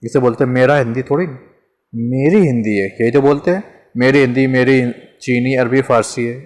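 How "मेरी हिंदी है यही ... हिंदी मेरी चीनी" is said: ordinary talk, nothing marked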